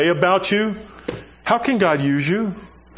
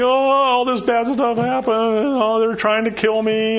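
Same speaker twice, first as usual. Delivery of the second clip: higher mock whine with sighing "oh"s; whiny Elijah; 7 semitones higher than usual. Elijah repeating his complaint to God, higher mock whine. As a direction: whimper it like a sulking prophet.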